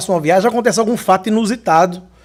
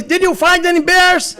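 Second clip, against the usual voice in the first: higher, drawn-out mock officer's question; oficial da imigração; 8 semitones higher, slower than usual. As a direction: imitate the officer calling out, teasingly. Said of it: "E ele olhou pra mim". (imitando o oficial, agudo e arrastado)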